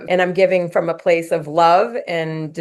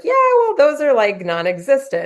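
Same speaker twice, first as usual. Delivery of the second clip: dismissive; 7 semitones higher than usual. Retold or imitated. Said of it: "And she was like".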